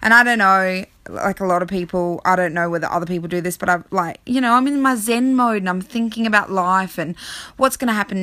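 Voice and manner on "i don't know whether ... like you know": ordinary talk, nothing marked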